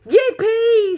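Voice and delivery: very high, loud whoop; joyful shout. Acted. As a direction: whoop with glee as the lift rockets. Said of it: "shouted Grandpa Joe again".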